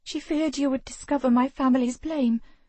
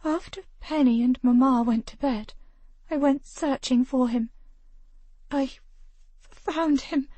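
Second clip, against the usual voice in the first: voice breaking; 6 semitones higher than usual. Acted. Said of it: "Her voice broke again".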